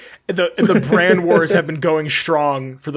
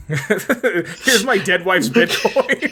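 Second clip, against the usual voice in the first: slow, laughing joke voice; laughing mock line; 3 semitones higher, much slower than usual. Joking about the dead wife's crypto, laughing. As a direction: giggle through a silly made-up line.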